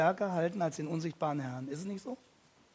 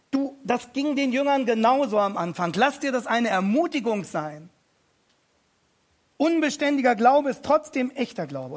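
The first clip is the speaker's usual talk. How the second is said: lauter, höher, mit Nachdruck predigen; eindringliche Predigt; 5 semitones higher, 6 dB louder than usual. Acted. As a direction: die Gemeinde direkt packen. Rises into preaching. (Predigt mit voller Kraft)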